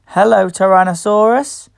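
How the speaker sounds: plain reading voice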